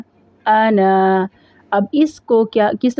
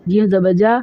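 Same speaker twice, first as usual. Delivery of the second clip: ordinary delivery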